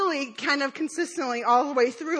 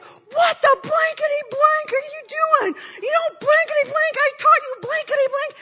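high-pitched mock scolding; hammed-up coach chewing out a swimmer, bleeped curses; imitated swearing rant; 11 semitones higher than usual. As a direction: play an exasperated coach telling someone off. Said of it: "he's just swearing at me".

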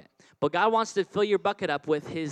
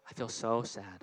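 mock sad; 7 semitones lower, 7 dB quieter than usual. Shown as a demonstration. Acting out an empty bucket.

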